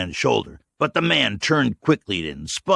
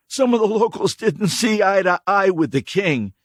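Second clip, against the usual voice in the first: high, loud, giving in; cornered drifter; 12 semitones higher, 5 dB louder than usual. Acted. Stranger caving under the rebar, hurried. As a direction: give up the name in a hurry. Dirty man's voice.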